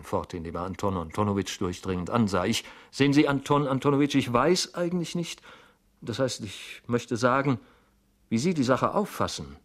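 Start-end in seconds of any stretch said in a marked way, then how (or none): 2.4-9.6 higher, halting, agitated explanation; nervous clerk correcting himself, pitched up; flustered Goljetkin, groping. A narrator's reading. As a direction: grope anxiously for the right words.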